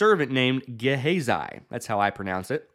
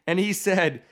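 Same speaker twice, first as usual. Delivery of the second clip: normal talking voice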